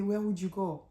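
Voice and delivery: mildly voiced menacing question; imitating him. Husband questions his fleeing wife, mildly menacing. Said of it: "that part when he said".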